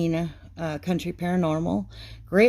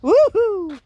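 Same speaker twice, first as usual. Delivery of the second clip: high, loud whoop of joy; excited cheer; 11 semitones higher, 6 dB louder than usual. Real, not acted. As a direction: let out a happy whoop. Cheering on the railing.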